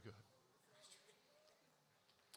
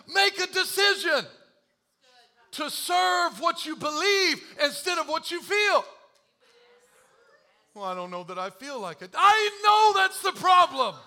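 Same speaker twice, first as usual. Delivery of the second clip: full-force preaching; 12 semitones higher, 13 dB louder than usual. Acted.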